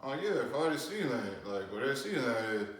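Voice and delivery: soft mumble through a jaw that won't move; broken jaw mumble. Shown as a demonstration. Answering the detective in the ambulance with a broken jaw, mumbling softly. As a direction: keep the mouth nearly shut and mumble. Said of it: "I'm talking like this 'cause".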